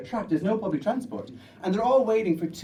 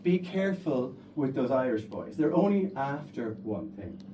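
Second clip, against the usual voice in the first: hushed, a hint of a worried mother; lightly voiced mother's warning; a touch of a cautious mom; 5 semitones lower, 5 dB quieter than usual. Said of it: "one of the girls' moms says".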